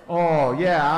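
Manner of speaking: ordinary talk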